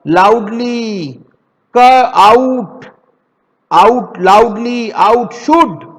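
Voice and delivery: लाउडली and आउट stretched slowly and said loud; slow repeat demo. Shown as a demonstration. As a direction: say each word twice, slowly. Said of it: "दो दो बार बोल के देखोगे ना". (धीरे-धीरे दोहराना)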